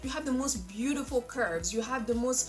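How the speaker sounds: high, gushing admirer voice; gushing compliment. Retold or imitated. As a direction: gush over someone's curves. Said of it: "people are like".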